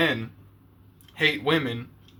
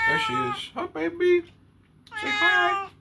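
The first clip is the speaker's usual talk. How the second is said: much higher, sing-song pet voice; much slower than usual. Real, not acted. Greeting his old cat on camera, high cooing baby talk.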